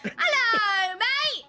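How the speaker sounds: high, loud mock-Australian greeting; bad Australian-sounding accent. Tourist greets customs agent, accent slipping into Australian. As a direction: belt out a botched British-turned-Australian hello. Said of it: "you'll do an Australian accent".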